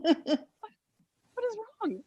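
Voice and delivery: high, soft and slow; tiny puzzled voice, imitated; mock bewildered question. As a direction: ask in drawn-out, exaggerated bafflement. Acts out her baffled question.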